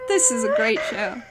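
ordinary talk, nothing marked

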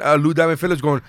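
ordinary talk

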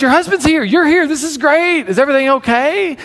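high-pitched, acted friendly excitement; imitating an over-eager pastor; eager greeting. Pastor eagerly greets wife in story.

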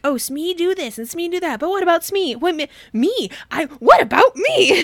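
high-pitched put-upon whine, building to a loud finish; hammed-up neglected spouse, swelling to an outburst; mock aggrieved complaint. Smee feeling ignored by Hook, mock aggrieved.

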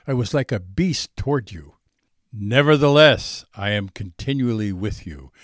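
normal talking voice

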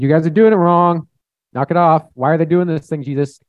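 high, loud mock scolding; aggressive Pharisee. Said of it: "rough, was very aggressive".

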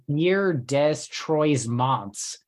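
Bière des Trois Monts drawn out very slowly, Americanised; mock French, mangled; butchered French. Shown as a demonstration. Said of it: "your pronunciations are fucking outrageous".

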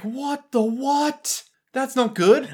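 slow, high-pitched bewilderment; mock disbelief. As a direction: draw it out high in hammed-up dismay. Acted reaction to bad news.

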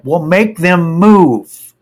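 loud, high, drawn-out command; retold impatient demand; exasperated order. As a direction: push the last word hard, fed up.

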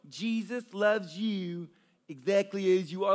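slow, low, soft sing-song talking down to babies; mocking baby talk. Mocking a preacher who treats prisoners like babies, slow baby talk. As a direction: coo it like talking to an infant. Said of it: "Like, this whole baby talk".

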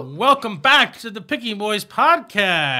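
upbeat slow host welcome; podcast intro. Acted. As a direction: open the show like a host.

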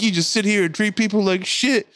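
high, escalating angry rant; imitated yelling. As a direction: imitate a man yelling down from upstairs, pitch climbing. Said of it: "Yells at her from the top steps".

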